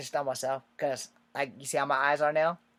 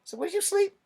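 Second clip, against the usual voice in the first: high, squeaky incredulous query; imitating a baffled sergeant; high mocking sergeant; 4 dB louder than usual. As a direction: needle the recruit.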